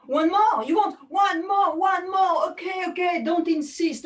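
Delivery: mock excitement. Feigns audience begging for an encore.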